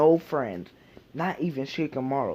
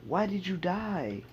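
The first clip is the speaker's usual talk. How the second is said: higher, mildly hurt question; mood shift to sad; 7 semitones higher than usual. Retold or imitated. Boy asks his father why he died, mild hurt. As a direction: ask it like a child who feels abandoned, understated. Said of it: "he'll change the mood and be like".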